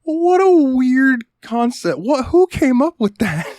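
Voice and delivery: high, slow, disbelieving voice; incredulous high voice. Retold or imitated. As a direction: squeak out the disbelief. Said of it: "And I'm like".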